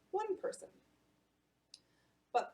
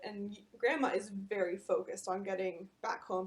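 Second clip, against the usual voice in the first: plain reading voice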